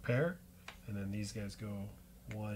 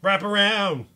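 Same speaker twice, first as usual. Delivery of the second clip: higher, louder card-name shout; called out; 6 semitones higher, 8 dB louder than usual. Pretend. Player calling out the wraparound card, called out. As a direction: announce the move with a shout.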